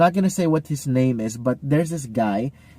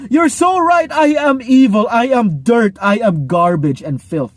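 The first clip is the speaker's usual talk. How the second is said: much higher and louder wail fading back down; mock groveling; 6 semitones higher, 6 dB louder than usual. Corrected person groveling to the one correcting him, hammed-up wail. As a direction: wail the self-hatred then let it sink.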